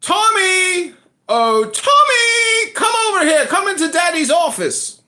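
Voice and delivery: high, loud put-on call; calling out to son. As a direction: sing out for the boy, drawing out the name. Calls his son into office.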